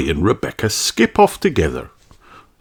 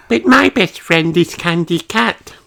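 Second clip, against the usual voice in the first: high little elephant voice, gently declining; gentle refusal; 10 semitones higher than usual. A narrator's reading. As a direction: let her down kindly. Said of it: "says Emily".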